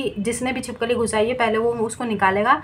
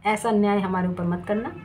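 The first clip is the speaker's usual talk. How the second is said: ordinary talk, nothing marked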